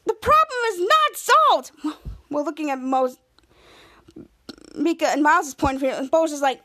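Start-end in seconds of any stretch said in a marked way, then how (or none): 0.1-1.7 high, drawn-out mock protest; exasperated retort. Bose objecting that it is far from over, exasperated. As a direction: object in a high, slow, dramatic cry. Retold character's loud objection.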